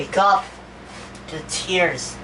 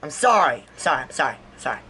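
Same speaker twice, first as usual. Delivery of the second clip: ordinary delivery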